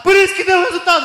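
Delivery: high-pitched triumphant reasoning; excited arguing. Acted. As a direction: argue it high, sure you have won. Ricardo arguing he has won.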